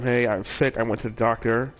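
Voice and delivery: usual speaking voice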